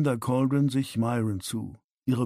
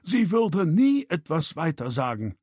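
higher, insistent defence; defensive, raised-pitch assurance; protesting; 6 semitones higher than usual. A narrator's reading. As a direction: insist she can be trusted. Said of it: "protestierte Bucky".

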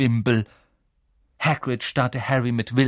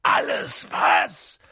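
slow, rough thundering echo; drawn-out, hoarse roar of disbelief; thundering outrage; much slower than usual. A narrator's reading. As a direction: thunder it back word by word. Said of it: "donnerte Hagrid".

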